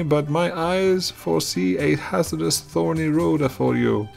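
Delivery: prophesy doom in a high, dramatic voice; fortune teller voice. Acted.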